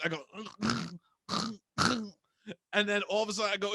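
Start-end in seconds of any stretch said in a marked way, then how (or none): 0.4-2.0 soft, strained retching grunts; mock gagging. Retelling the pesto story to a friend, mock gagging. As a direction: gag quietly like something is stuck. Kid gagging as pasta comes up.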